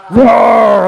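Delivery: loud, long mock bear roar; playful bear roar. Pastor playing bears with a child, loud mock roar.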